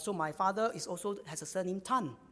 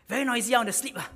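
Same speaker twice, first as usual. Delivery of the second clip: louder, higher mock grumble; retold complaint; 4 semitones higher, 6 dB louder, much faster than usual. Child to chatting parents at night, retold complaint. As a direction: imitate a sleepy child complaining about noise.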